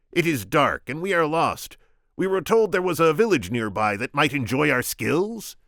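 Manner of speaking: high, loud Vizzini voice; squeaky scheming Sicilian. Acted. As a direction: pitch him up, sharp and cocky.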